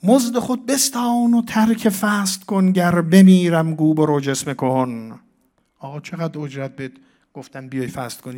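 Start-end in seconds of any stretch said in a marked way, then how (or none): 0.0-5.2 loud, high desperate shout; Majnun's cry. Acted. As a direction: cry out to the bloodletter to stop. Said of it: "مجنون، فریاد زد".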